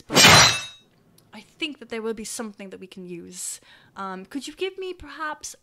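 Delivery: lightly voiced, considered townsperson; helpful townsperson character. Townsperson offers adventurer a cloak repair, measured and helpful. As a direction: weigh up the job helpfully. Plays tailor discussing the repair.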